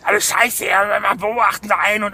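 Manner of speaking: lightly voiced bitter old woman; a hint of a grumbling fairy-tale witch; old hag voice. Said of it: "das ist die Hexenstimme aus dem Märchen".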